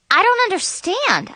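high-pitched, bewildered protest; upset disbelief. A narrator's reading. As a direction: blurt it out in dismay. Protesting disqualification, near tears.

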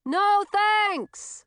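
high, booming refusal; high-pitched hearty character shout; loud witch. A narrator's reading. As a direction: holler it high and cheerfully long.

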